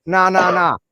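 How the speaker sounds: hammed-up small-town bully; mock hostile local. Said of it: "And I'm like".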